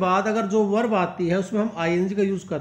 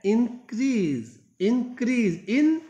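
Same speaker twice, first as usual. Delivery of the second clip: "increase" drawn out slowly, first syllable IN stressed; 4 semitones higher, much slower than usual. Shown as a demonstration. Teacher shows students the noun increase, stress on IN. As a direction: punch the IN of "increase", stretch it out. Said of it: "loudly बोला जाएगा".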